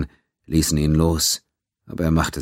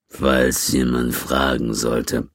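low, slow villain voice; Gans, menacing boss; 4 semitones lower, slower than usual. A narrator's reading. Gans addresses the beaten Quinn, low and slow. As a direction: draw it out low and deliberate.

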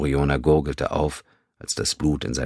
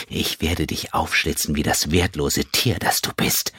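menacing threat; 2 semitones higher than usual. Acted. Nira droht Jano.

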